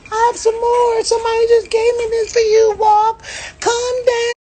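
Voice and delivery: very high falsetto soothing plea, slow. Retold or imitated. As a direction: mimic a frightened woman begging him to calm down.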